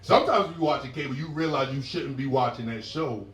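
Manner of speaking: ordinary talk, nothing marked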